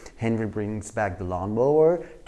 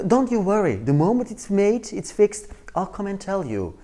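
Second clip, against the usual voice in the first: high-pitched, gushing fake apology; manipulative; 6 semitones higher, 5 dB louder than usual. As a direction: lay on the regret to cover a lie. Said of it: "That's what we call manipulative behavior".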